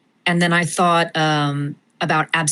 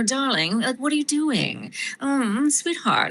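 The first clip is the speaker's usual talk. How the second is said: catlike, higher-pitched pretentious darling; Pamela; 7 semitones higher, 4 dB quieter than usual. Acted. Actress showing how her character became a cat, purring. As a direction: purr it like a pampered cat. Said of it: "kind of became a little bit of a cat".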